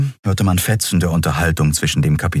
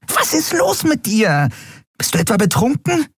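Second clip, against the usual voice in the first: very high, agitated squeal; frantic, squeaky scolding; hysterical. A narrator's reading. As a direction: panic in a tiny high-pitched voice. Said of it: "Wär doch nicht gleich hysterisch".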